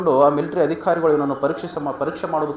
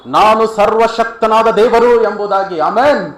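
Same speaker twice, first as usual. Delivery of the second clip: loud, high, driving proclamation; fervent preacher calling out to the congregation; full-force preaching; 5 semitones higher, 8 dB louder than usual. Real, not acted. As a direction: build phrase on phrase to a shouted climax.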